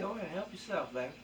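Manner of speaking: high, soft smooth pimp croon; smooth flashy pimp swagger. Acted. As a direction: purr it like a slick pimp. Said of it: "You're doing it again".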